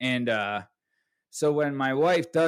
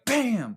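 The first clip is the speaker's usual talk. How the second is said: punch sound effect; 10 semitones higher, 3 dB louder than usual. Retold or imitated. Retelling a punch.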